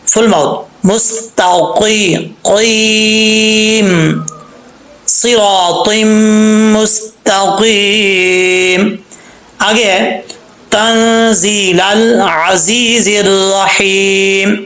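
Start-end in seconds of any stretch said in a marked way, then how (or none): 0.9-9.0 slow, drawn-out chanted recitation; tajweed recitation; Quranic recitation. Shown as a demonstration. Recites the verse. 10.7-14.6 slow, drawn-out chanted recitation; tajweed recitation; Quranic recitation. Shown as a demonstration. Recites the verse.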